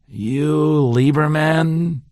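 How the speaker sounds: slow, flattened American question; a lightly American take, drawn out. Acted. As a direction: drop the German, go American, slowly. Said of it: "more American, less German".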